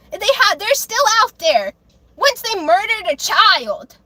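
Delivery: real anger. Real, not acted. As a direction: let the outrage spill over. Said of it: "now I'm ranting about it".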